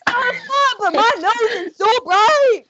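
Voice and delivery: high, loud, over-excited reindeer yell; hammed-up high-pitched bragging; obnoxious Rudolph. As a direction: shout it like an obnoxious show-off, drawing out the words. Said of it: "like, in an obnoxious way".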